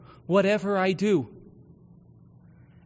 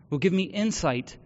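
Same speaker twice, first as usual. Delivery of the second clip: ordinary delivery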